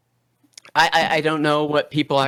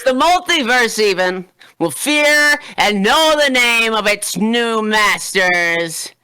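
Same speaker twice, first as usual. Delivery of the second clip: ordinary talk, nothing marked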